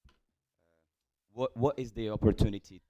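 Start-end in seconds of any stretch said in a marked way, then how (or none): none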